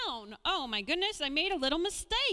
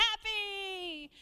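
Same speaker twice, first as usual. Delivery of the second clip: cheerful echo; 7 semitones higher than usual. Real, not acted. Echoing child's answer.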